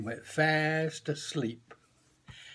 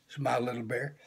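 usual speaking voice

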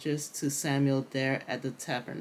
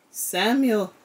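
louder, higher call of the name; 7 semitones higher, 6 dB louder than usual. A narrator's reading. The Lord calls Samuel a second time, calling out. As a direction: call the name out with rising force. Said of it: "the Lord came and called".

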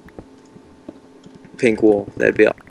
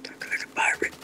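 soft breathy whisper; 14 dB quieter than usual. Real, not acted. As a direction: whisper it as an aside. Whispered aside.

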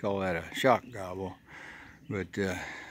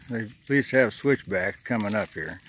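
ordinary talk, nothing marked

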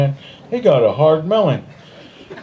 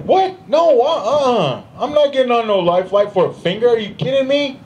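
much higher, disbelieving "What? No, uh-uh."; incredulous refusal; 10 semitones higher than usual. Retold or imitated. Bitten mugger to medics, refusing Life Flight in disbelief. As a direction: balk at a helicopter ride for a finger.

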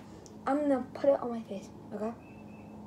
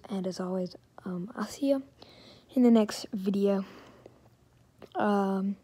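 whispering; 3 semitones lower than usual. Real, not acted. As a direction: whisper the goodbye. Whispers sign-off next morning.

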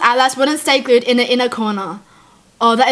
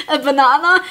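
laughing disbelief. Real, not acted. Repeats in disbelief.

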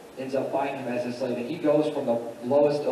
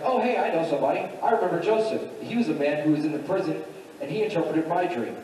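higher-pitched, eager acted quote; excited realization; 4 semitones higher than usual. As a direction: pipe up with a sudden recollection. Cupbearer suddenly remembers, tells Pharaoh.

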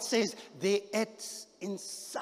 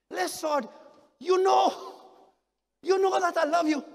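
high, loud insistent answer; louder reply; 10 semitones higher, 5 dB louder than usual. Acted. Peter answering Jesus again, louder and high. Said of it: "Talk a little louder now".

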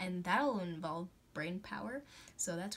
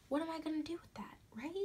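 fast teasing whisper; mock whisper; 5 semitones higher, 8 dB quieter, faster than usual. Vlogger teasing viewers in a playful whisper. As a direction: hiss the question playfully under your breath.

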